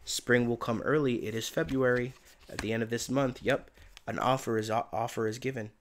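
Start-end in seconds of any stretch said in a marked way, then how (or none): none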